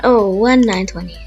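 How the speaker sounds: ordinary talk, nothing marked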